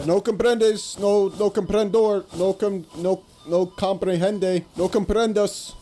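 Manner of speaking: "No comprendes, no, no comprendo" slowed and mangled; halting broken Spanish; fumbled Spanish. Retold or imitated. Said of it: "Sounds like a fucking wizard spell".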